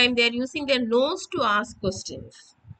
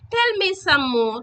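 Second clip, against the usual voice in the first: eager, higher exclamation; a doctor eagerly begging for more; excited doctor; 7 semitones higher, 3 dB louder than usual. A narrator's reading. Doctor excitedly asks the parrot for more, eager. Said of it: "all excited".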